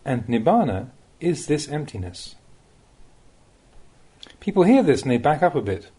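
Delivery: ordinary delivery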